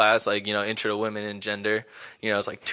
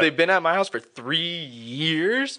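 earnest mom; 5 semitones higher than usual. Retold or imitated. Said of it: "And my mom's like".

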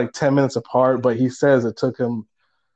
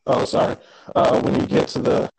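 robotic glitchy audio. Real, not acted. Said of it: "There we go".

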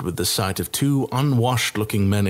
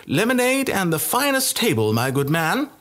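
mock-regal order pitched much higher; haughty princess; 10 semitones higher than usual. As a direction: play the grand lady for fun. Princess orders with raised nose.

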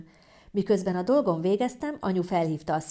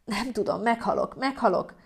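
higher, agonised moan; pained wailing; 4 semitones higher than usual. Acted. Woman in labour telling mother she's dying, pained. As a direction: moan it as the pain builds.

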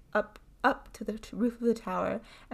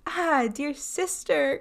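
slow, higher-pitched distressed cry; 6 semitones higher, 4 dB louder, slower than usual. A narrator's reading. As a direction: cry out to your sister, drawing it out. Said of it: "cried Fatima".

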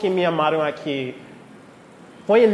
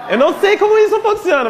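laughing; 12 semitones higher, 4 dB louder, faster than usual. Real, not acted. Laughing at his old accent.

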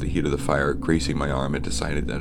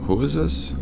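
confused question; 12 semitones higher than usual. Acted. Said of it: "I inquired, confused".

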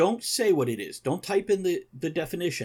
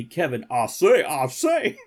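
joking British impression, louder; mock British accent; 6 dB louder than usual. Teasing Kevin as a British friend would, mock British accent. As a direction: put on a playful British accent. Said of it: "unless he's British".

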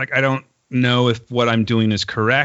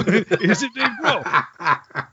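amazed question; 9 semitones higher, much slower than usual. Retold or imitated. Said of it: "you know".

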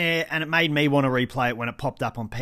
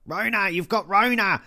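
mock screaming; 6 semitones higher than usual. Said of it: "She's still like".

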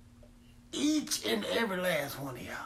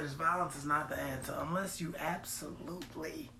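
whispered aside; 4 semitones lower, 10 dB quieter than usual. Acted. Mock podcast moral whisper.

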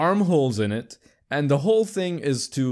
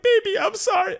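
very high, hammed-up pleading; mock pleading apology; 4 dB louder than usual. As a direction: beg forgiveness in a squeaky mock voice. Retold apology to crying girlfriend.

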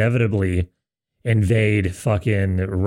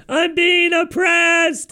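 slow, much higher hammed-up shriek; mock scream; 3 dB louder, much slower than usual. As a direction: scream it as a panicked soldier parody. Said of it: "you're just screaming".

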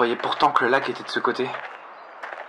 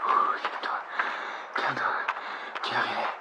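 whispering to himself; 5 dB quieter, faster than usual. Acted. Calming himself in panic.

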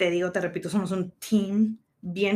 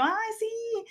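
shy answer; 14 semitones higher, 5 dB quieter than usual. Retold or imitated. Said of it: "Y lo yo".

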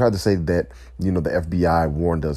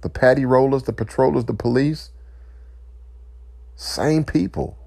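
impassioned outcry; 9 semitones higher than usual. Real, not acted. Impassioned protest against police racism.

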